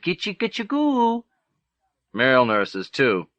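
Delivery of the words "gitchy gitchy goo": put-on much higher, cooing baby talk. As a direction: coo at him like a baby. Nurses cooing at patient.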